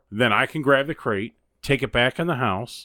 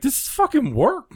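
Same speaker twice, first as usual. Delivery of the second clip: exasperated. Retold or imitated. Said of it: "And I'm like".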